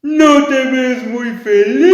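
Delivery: voz de monstruo más fuerte; monstruo burlón. Acted. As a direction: monstruo que provoca a la princesa. Monstruo habla a la princesa. (monstruo burlón, más fuerte)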